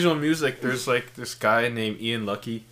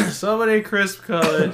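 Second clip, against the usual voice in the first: higher, hammed-up announcer read; 7 semitones higher than usual. Shown as a demonstration. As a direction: sell it like a TV ad. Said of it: "after that you say".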